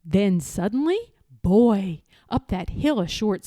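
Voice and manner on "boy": ordinary delivery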